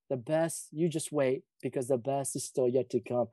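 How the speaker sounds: soft, tender Bible quote; hushed promise. Real, not acted. Pastor softly quoting scripture to the congregation, tender.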